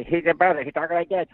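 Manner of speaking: nervous little brother holding back; timid Luigi. Retold or imitated. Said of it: "A little bit more trepidatious".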